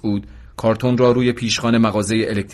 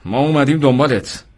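higher, forceful statement; rough announcement; 5 semitones higher, 4 dB louder than usual. A narrator's reading. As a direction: confront the man you just slapped. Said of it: "سیلی محکمی به مرد زد و گفت". (تند و خشن)

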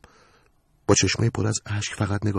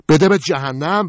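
higher, louder, slow furious retort; angry; 8 semitones higher, 8 dB louder, slower than usual. Acted. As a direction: spit it out in anger. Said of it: "با عصبانیت بهش گفت". (با عصبانیت و صدای بلند)

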